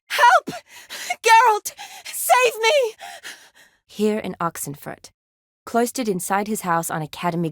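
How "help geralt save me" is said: loud, very high screams; piercing, panicked shouts; terrified cry for help. Acted. Screaming for rescue.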